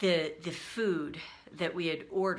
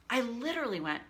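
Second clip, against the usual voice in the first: normal talking voice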